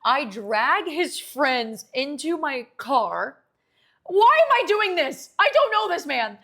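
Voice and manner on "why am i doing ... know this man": much higher, loud disbelief; loud, high rhetorical disbelief; exasperated outburst. Real, not acted. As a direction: cry out at the absurdity. Exasperated retelling of absurd night.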